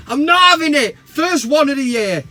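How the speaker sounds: high, loud indignant rant; hammed-up indignant yell; mock outrage. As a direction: bluster at the cheeky fan. Outraged at fan comparison.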